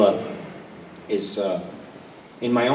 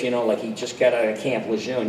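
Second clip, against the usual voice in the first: usual speaking voice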